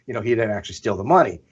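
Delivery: ordinary talk, nothing marked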